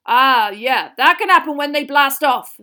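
loud, hearty gamekeeper voice; Hagrid, unbothered. A narrator's reading. Hagrid nodding at Dean's burn, loud and matter-of-fact. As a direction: explain it loudly and easily.

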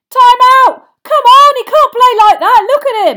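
very high, very loud outcry; urgent protest shout. Acted. Fan demands time out for an injured player, yelling. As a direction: yell at the referee from the stands.

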